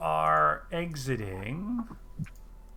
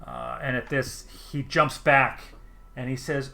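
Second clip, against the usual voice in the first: ordinary talk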